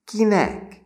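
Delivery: very high, loud taunting demand; dangerous grin. A narrator's reading. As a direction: press the frightened victim for an answer. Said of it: "kérdezte veszedelmesen vigyorogva".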